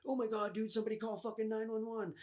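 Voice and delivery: high, fast panicked voice; panicked friend. Retold or imitated. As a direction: blurt it out in alarm. Imagined friends panicking over body.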